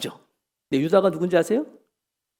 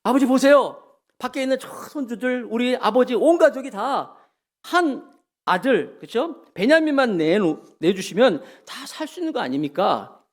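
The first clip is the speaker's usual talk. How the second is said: wise pleading; 8 semitones higher, 3 dB louder than usual. Retold or imitated. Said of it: "지혜롭게 얘기하는 거예요".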